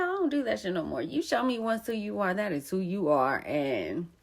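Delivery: ordinary delivery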